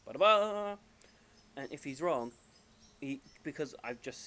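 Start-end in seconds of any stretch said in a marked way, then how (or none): 0.1-0.8 loud, high sung-out jingle; vocal drum-roll fanfare, last note held; imitated game fanfare. Professor reveals his answer, mock fanfare.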